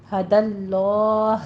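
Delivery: ہَدَى اللَّهُ slow, with a full heavy lam; heavy lam of Allah. Shown as a demonstration. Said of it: "ہم پر پڑھیں گے".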